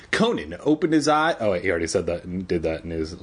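ordinary delivery, nothing marked

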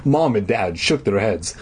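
ordinary delivery

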